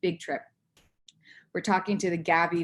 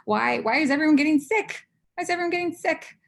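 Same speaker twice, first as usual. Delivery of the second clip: high-pitched, incredulous why; worked-up, baffled outburst; exasperated questioning; 10 semitones higher, 4 dB louder than usual. Retold or imitated. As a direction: ask it in mock alarm, pitched right up. Acted exasperated question about colleagues.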